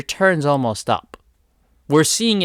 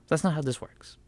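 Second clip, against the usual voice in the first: whisper; 8 dB quieter than usual. Real, not acted. Scolding aside after emphatic nos.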